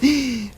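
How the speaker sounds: sharp, high intake of breath; startled gasp. Real, not acted. Gamer thinks he is dying, startled high gasp. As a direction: gasp in sudden fright.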